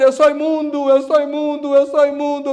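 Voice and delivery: a loud, repeated lament of "unclean"; mock crying out. Preacher mimicking people who cry out their shame. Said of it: "gente gritando".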